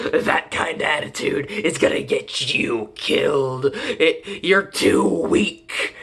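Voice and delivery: put-on brooding edgy voice; edgy anime kid. Slayer goads Tanjiro, mock edgy. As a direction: goad him like an edgy anime rival, drawing out the end. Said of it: "turns into that one edgy fucking kid".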